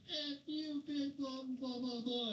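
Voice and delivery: high-pitched mocking imitation of a screaming woman; hammed-up imitation of a drunk woman cursing; mock drunk yell. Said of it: "Then I was like".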